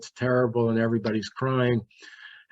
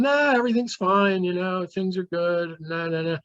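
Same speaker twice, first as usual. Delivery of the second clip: high, bright and breezy, much higher; cheery party voice; 8 semitones higher than usual. Retold or imitated. Sister on the phone to her brother, faking a higher party cheer. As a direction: sister brushing it off like all's well at a party. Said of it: "She sounds like she's at a party".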